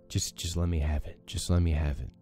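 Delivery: ordinary talk, nothing marked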